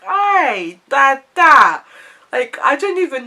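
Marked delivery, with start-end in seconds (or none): 0.1-1.8 stunned. Retold or imitated. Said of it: "And I was like".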